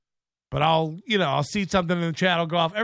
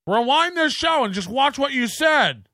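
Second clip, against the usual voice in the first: higher-pitched, hammed-up insistence; mock indignant order; 6 semitones higher than usual. Imitating Corey chasing views, mock indignant. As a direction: imitate a host demanding a rewind.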